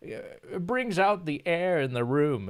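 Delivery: light mock of a pompous rich homeowner; a hint of a haughty aristocrat; rich snob. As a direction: play a stuck-up rich man showing off his study. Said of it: "rich bastards ... they're like".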